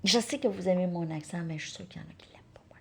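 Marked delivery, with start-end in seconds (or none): none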